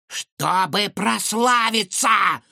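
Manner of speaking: high, slow proud shout; mad scientist. A narrator's reading. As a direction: proclaim grandly. Villain proclaims ambition.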